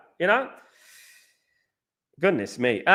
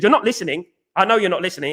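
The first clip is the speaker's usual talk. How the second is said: ordinary talk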